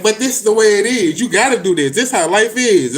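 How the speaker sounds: hint of preachy conformist; mocking everyone. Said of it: "And everybody like".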